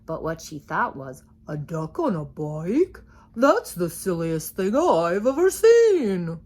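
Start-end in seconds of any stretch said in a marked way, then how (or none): 1.5-6.4 louder, slow scoffing cow; skeptical cow. A narrator's reading. Cow thinking about the duck on a bike, slow scoffing voice. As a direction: scoff at the silly sight. Cow's thought.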